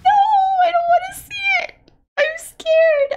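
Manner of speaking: very high, frightened wail; high-pitched fear. Real, not acted. Scared player dreading what comes next, high-pitched wailing. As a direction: whine in fear at the top of your range. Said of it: "sorry for the high pitchiness".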